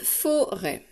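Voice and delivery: FORÊT split into fo and rêt; two separate beats on FORÊT; syllable by syllable. Shown as a demonstration. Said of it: "Je tape forêt".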